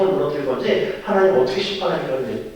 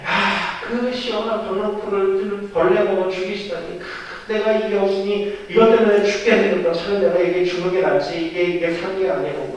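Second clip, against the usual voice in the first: higher-pitched, aggrieved lament; blustering anger; 5 semitones higher than usual. Retold or imitated. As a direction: fume at God over a petty loss. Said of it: "또 승리를 버럭버럭 내는 거야".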